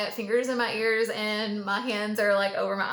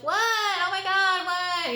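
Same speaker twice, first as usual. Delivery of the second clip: higher, louder, slow, a hammed-up bewildered little kid; loud kid confusion; 10 semitones higher, 5 dB louder, slower than usual. Retelling her childhood self at the park, mock loud confusion. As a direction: play the loud silly kid who doesn't get why. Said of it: "And I was like".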